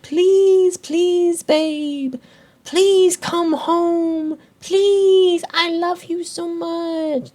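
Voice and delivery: high, slow whiny pleading; hammed-up high whimpering plea; mock begging. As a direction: beg pathetically like a lovesick man. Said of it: "begging for her love".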